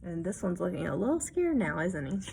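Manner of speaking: usual speaking voice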